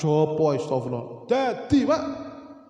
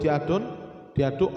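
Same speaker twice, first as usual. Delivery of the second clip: ordinary talk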